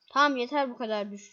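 ordinary talk, nothing marked

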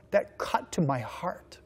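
normal talking voice

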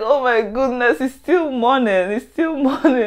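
retold despair. Retold despair at waking late.

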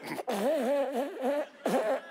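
soft, distant divine chuckle; God laughing. Retold or imitated. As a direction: let God chuckle at you. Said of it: "He went".

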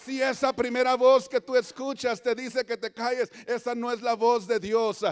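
loud exhortation building in force; preach. Real, not acted. (exhortación fuerte, cada vez más intensa)

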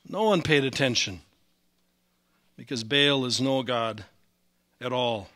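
ordinary talk

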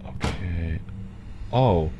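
ordinary delivery

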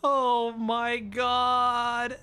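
very drawn-out oh my God; real stunned drawl; slow disbelief. Real, not acted. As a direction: stretch it in disbelief. Drawn-out disbelief, then laugh.

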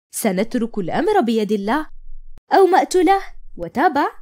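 plain reading voice

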